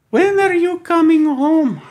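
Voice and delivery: high, slow, laughing mom voice; a mom calling her son home, high and teasing; mocking mom on the phone. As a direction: squeak it out like a nagging mother. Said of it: "You have to do the accent".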